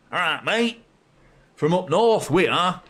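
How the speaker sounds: a playful stab at a Yorkshire voice; Yorkshire accent. Pretend. Fan joking that his side are proper northerners, in a light Yorkshire accent. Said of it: "Sounded like Yorkshire".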